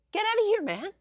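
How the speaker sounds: high, loud scoff at the haters; mock indignation. Fan telling jealous rivals off, high mock indignation. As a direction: pitch it way up, laughing them off.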